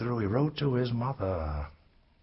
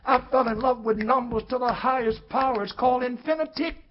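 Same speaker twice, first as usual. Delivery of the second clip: higher and louder, thrilled and rising; soaring exclamation; 11 semitones higher, 6 dB louder than usual. Acted. Storyteller proclaims his love of numbers, higher and louder. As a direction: lift it up like a revelation.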